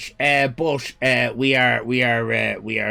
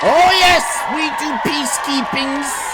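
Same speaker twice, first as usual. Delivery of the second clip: mock proud shout; 8 semitones higher, 4 dB louder than usual.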